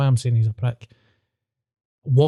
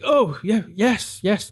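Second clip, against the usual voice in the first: mock flustered reply; 8 semitones higher, slower than usual. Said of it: "he was like".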